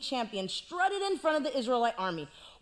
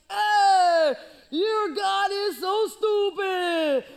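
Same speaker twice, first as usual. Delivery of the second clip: loud, slow, high booming taunt; hammed-up giant yell; giant's taunt; 6 semitones higher, 7 dB louder, much slower than usual. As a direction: roar it across the valley.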